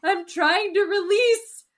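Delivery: high, loud imitation of a hysterical plea; hammed-up tearful desperation; mock breakdown. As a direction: wail it as if falling apart. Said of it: "Full breakdown".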